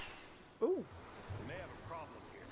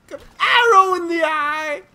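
loud gleeful cry; 6 semitones higher, 8 dB louder than usual. Real, not acted. Gleeful yell at enemy.

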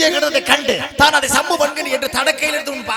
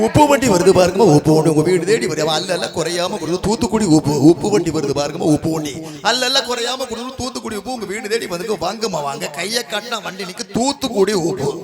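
singsong street hawker; 4 semitones lower, 3 dB louder than usual. Acted. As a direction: cry your wares to the housewives. Salt cart hawker, louder.

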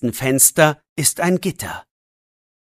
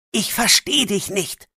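a touch of grumbling; half-hearted scolding; mild scolding; 7 semitones higher than usual. Acted. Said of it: "schimpft Jannik". (leicht schimpfend)

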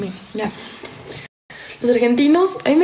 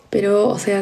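ordinary talk

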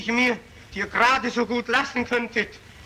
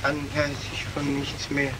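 hushed confession; 11 semitones lower, 10 dB quieter than usual. Acted. Murderer to the court, low hushed murmur. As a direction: drop far lower and softer, almost lost.

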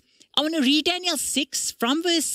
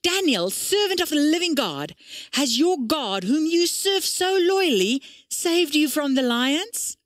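anxious call; 5 semitones higher than usual. A narrator's reading. King calls anxiously to Daniel in the lions' den. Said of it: "he called out anxiously".

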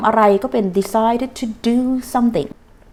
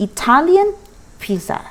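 slow model phrase; 6 semitones higher, much slower than usual. Shown as a demonstration. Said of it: "พิซซ่าอิตาลีใช้คำว่า".

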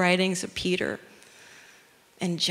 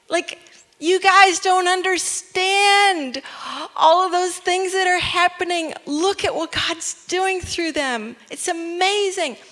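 high, gushing, over-the-top enthusiasm; excited amazement; 10 semitones higher, 3 dB louder than usual. Acted. Preacher as Paul urging listeners to look at God's work, excited amazement. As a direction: urge them to see it, thrilled and pitched up high. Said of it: "think about somebody saying this".

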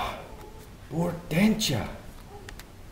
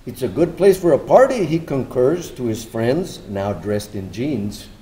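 poem reading; 3 dB quieter than usual. Acted. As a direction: read it like a poem about the city. Poetic narration between speakers.

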